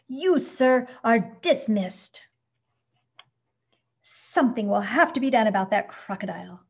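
loud, emphatic, indignant dismissal; rage. A narrator's reading. Mister Grumps firing Signor Valenti, enraged. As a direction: lay down the law angrily. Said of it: "purple with rage".

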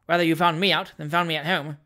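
cool, unruffled retort; unmoved. Acted. Stranger replying to doctor's threats, unmoved. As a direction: answer threats with indifference. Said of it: "replied the unmoved stranger".

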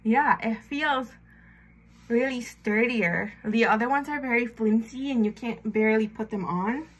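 talking with fingers at mouth; pressing strip onto teeth; whitening strip on teeth. Real, not acted.

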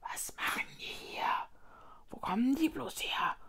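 hushed, anxious whispering of several voices; excited whispering. A narrator's reading. Hidden cave voices fear being caught, excited whispering. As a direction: whisper nervously, partly voiced. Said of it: "die aufgeregt durcheinander tuschelten".

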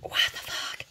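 breathy whisper of disbelief; whispered quote. Retold or imitated. Friend confronting Irma, in a whispered what the fuck. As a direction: whisper it, stunned. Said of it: "asked her".

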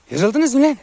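very high, loud shout at the dogs; shouted telling-off. Real, not acted. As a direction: yell the question at the pack.

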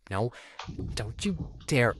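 normal talking voice

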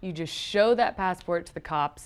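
ordinary delivery

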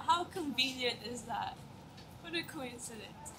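ordinary talk, nothing marked

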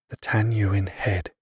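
slow, awed whisper; hushed whisper. A narrator's reading. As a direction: breathe the name out in awe. Awed reveal of the leader.

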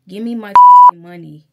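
sudden shouted demand; furious shout for her money; yelled demand. Real, not acted. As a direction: yell it at the teller. Reliving the bank fight.

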